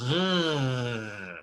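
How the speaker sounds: "re" growled at the back of the throat; uvular R. Shown as a demonstration. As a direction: demo the French uvular "re". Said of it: "that's a uvular R".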